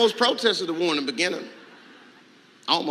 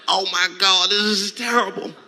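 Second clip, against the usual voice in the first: hammed-up plea, higher and louder; mock desperation; 6 semitones higher, 5 dB louder than usual. Mock despair at home.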